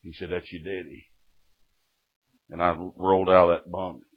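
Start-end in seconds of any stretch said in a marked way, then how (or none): 0.3-1.1 put-on low, soft old convict; lightly voiced old-timer; old jail inmate. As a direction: say it quietly from the bunk. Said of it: "one of them kind of guys".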